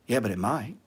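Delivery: a hint of a scared investor muttering; mock timid worrier. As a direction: mimic the nervous doubter lightly. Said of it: "people talk like this".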